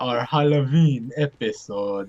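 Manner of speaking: low, slow vampire voice; spooky voice. Acted. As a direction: drag it out low like a creepy vampire. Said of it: "resident vampire".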